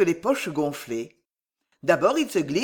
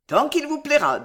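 higher, mocking comeback; defiant retort; 8 semitones higher, 4 dB louder than usual. A narrator's reading. Renard answers the cursing merchants, defiant retort. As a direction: toss it back, pitched up.

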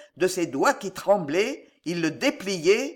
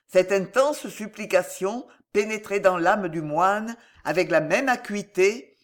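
plain reading voice

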